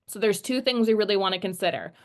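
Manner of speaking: ordinary talk, nothing marked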